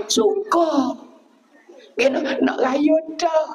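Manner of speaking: high-pitched gleeful mimicry; drawn-up high voice of pretend joy; mock delight. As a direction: imitate people thrilled for Raya, pitched high. Mimicking people glad that Ramadan ends.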